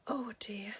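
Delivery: ordinary delivery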